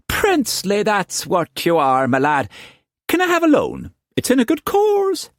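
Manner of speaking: high, coaxing flatterer; butter him up, pitched high; wheedling Quantz. A narrator's reading.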